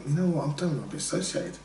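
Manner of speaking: a soft embarrassed aside; muttered aside. Retold or imitated. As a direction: say it under your breath. Embarrassed Brit abroad, inner voice.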